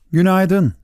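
put-on high, polite little boy greeting. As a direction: greet the mountains politely and brightly. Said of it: "dedi kibarca".

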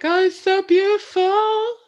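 high, slow, tearful-sounding gush; high mock-emotional swoon; overwhelmed gushing. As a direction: gush like you're about to cry with joy. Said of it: "Oh, I'd be like".

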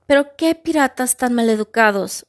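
plain reading voice

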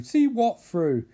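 high, loud, slow disbelief; incredulous outburst, stretched out. Real, not acted. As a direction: demand it in exaggerated disbelief. Incredulous interjection at the story.

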